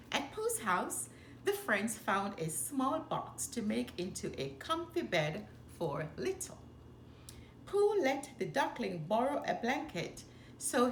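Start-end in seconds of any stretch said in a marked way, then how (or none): none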